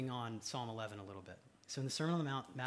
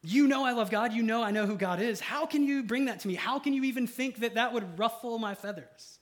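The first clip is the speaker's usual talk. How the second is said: much higher and louder, incredulous outrage; indignant King David; 9 semitones higher, 10 dB louder than usual. Retold or imitated. David answering his taunters, loud indignant disbelief. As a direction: fire back at the taunters in disbelief. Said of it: "He's like".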